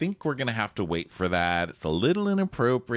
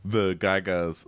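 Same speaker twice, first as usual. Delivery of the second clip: ordinary talk, nothing marked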